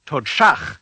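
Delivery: Todshach with tongue-curled sh and rasped ch; Klingon sh. Shown as a demonstration. Teacher has learners hear the second syllable, Todshach with forceful shach. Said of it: "focusing on the second syllable".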